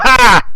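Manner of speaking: loud, high excited yell; going-nuts shout. Retold or imitated. Fan re-enacting his reaction to the superkick, wild yell. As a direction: burst out with a wild fan's yell. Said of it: "I went nuts".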